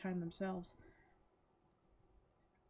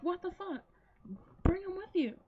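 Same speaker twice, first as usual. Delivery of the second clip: high-pitched exasperated cry, louder at the end; indignant protest; 9 semitones higher, 3 dB louder than usual. Retold or imitated. Viewer yelling at the pet sitter who ran away, indignant. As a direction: plead in mock outrage, pitched well up. Her indignant plea to fleeing character.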